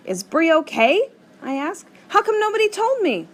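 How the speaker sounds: high, loud, worried pleading questions; panicking about a little sister; alarmed. A narrator's reading.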